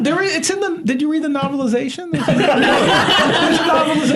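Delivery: high laughing reveal. Real, not acted. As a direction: laugh out the news.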